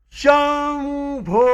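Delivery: very slow, high sustained call; all-out shout. A narrator's reading. Both friends cry out together, all-out drawn-out call. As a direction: hold it long and high with all your breath. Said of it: "जीव खाऊन ओरडलो".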